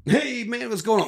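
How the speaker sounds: high, breezy hey man; friendly greeting voice. Retold or imitated. Sam Rockwell greeting the guard, breezy and high.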